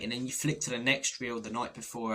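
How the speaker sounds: ordinary delivery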